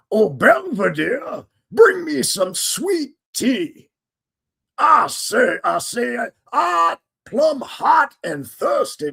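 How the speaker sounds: high, slow, loud Southern drawl; hammed-up old Southern gent calling his servant; Southern gentleman. Southern gent calling Belvedere for sweet tea, slow drawl. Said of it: "you're gonna be like".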